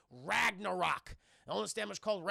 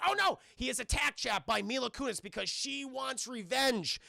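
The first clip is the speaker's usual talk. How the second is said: ordinary talk, nothing marked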